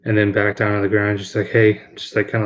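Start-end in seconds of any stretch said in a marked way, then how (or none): none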